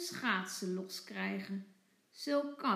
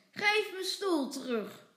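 put-on loud, higher angry child's demand; boos roepen; 7 semitones higher, 6 dB louder than usual. A narrator's reading. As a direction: snap it back like an angry little boy. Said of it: "roept Michiel boos". (boos en luid geroepen)

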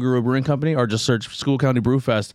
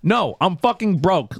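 high, slow, emphatic protest; retold exasperation; 7 semitones higher, slower than usual. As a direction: drag out the refusal, pitched up. Retold plea to bill collector.

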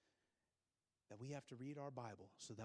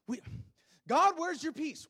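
loud, impassioned preaching to the congregation; full-force preaching; 13 semitones higher, 17 dB louder than usual. Acted.

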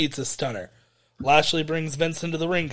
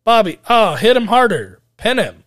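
raised, louder voice with lazy swooping tune; mock half-hearted cheering; 5 semitones higher, 6 dB louder than usual. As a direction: imitate someone going through the motions of cheering. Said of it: "half-heartedly being like".

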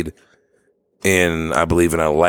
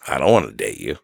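low, dismissive retort with a questioning lift; offhand, mildly rude comeback; rude brush-off; 8 semitones lower than usual. Retold or imitated. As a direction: toss back a blunt, low-pitched rejection. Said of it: "the way I said that probably was kind of rude".

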